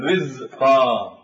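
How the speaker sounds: رزقا said very slowly with a light, thin ra; teacher drawing out رزقا with a thin ra; thin ra demo. Shown as a demonstration. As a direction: drawl رزقا so the thinned ra is heard. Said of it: "فالراء مكسورة يجب أن ترقق".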